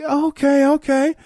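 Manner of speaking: high, impressed okays. Retold or imitated. Newcomer impressed by the who's who, high and wowed. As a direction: sound wowed by the room.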